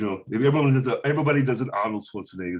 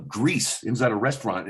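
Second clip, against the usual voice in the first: ordinary delivery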